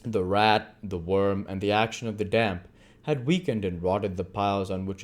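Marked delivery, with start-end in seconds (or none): none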